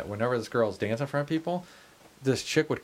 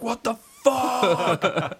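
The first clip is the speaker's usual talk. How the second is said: much higher, dragged out very slowly, laughing; confused what the fuck; 11 semitones higher, 4 dB louder, much slower than usual. Retold or imitated. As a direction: stretch it out in baffled disbelief. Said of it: "he's like".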